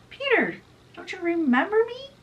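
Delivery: high, pleading question; hurt pleading. Acted. James pleads with Peter to remember him, high and hurt. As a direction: beg him to remember you. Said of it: "James is like".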